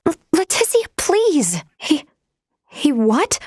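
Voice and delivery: flustered android begging her to stop; flustered plea. Acted. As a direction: caught off guard and pleading, voice jumping up.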